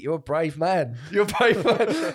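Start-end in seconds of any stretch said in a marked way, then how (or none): 1.1-2.1 slow, louder, higher teasing drawl with a laugh; mocking taunt. Taunting the snooty BBC presenter, slow mocking drawl. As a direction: needle him, stretch it out. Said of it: "started to get in his head".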